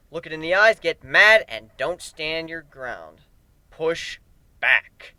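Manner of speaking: ordinary delivery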